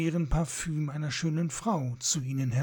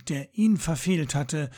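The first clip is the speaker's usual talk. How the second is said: usual speaking voice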